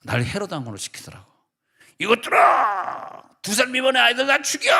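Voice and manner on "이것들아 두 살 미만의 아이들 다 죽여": high, loud, slow tyrant's roar; Herod shouting. Acted. Boy playing Herod in a Christmas play, shouting orders. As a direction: bellow like a cruel king. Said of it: "소리 막 지르는 게 있는데".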